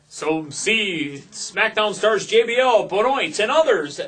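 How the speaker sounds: Benoit drawn out slowly and loud like a promo announcer; announcer mispronouncing. Retold or imitated. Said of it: "wasn't told how to pronounce Chris's name".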